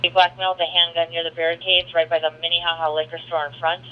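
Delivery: ordinary delivery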